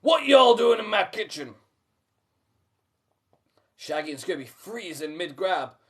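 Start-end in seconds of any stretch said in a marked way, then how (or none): 0.0-1.5 loud Southern command; strict command. A narrator's reading. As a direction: stop them in their tracks. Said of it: "a strict voice commands".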